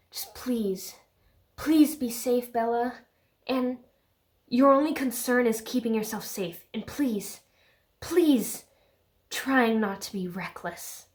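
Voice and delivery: halting, pleading imitation of Edward; hammed-up lover's parting plea; mock earnest plea.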